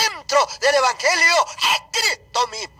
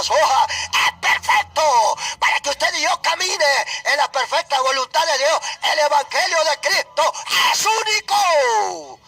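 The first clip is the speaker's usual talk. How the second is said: fiery preaching; 5 semitones higher than usual. Acted. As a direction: cry it out like a revival preacher. Preaching at full force. (predicación a gritos, fervorosa y lenta)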